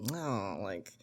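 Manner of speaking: softly imitating an airy Valley Girl; hint of Valley Girl. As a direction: lightly mimic her interview voice, quietly. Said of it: "like, not Valley Girl".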